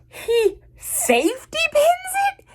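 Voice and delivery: very high, drawn-out squeal; appalled disbelief. Real, not acted. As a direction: stretch it into an incredulous shriek of a question. Appalled reaction to a plot detail.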